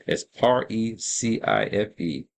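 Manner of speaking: ordinary talk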